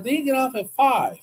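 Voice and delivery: the high woman at the door, drawn out. Retold or imitated.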